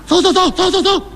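high, hollering crowd call; mock shouting. Thugs yelling at the crowd to move, shouting high. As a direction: bark it out like thugs clearing a path. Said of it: "听那旁有人直嚷嚷".

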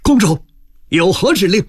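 high, eager voice of a subordinate; deferential officer. A narrator's reading. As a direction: report respectfully for orders, pitched well up. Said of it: "拱手道".

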